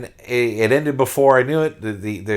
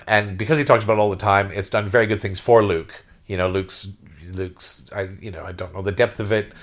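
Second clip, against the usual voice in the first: ordinary delivery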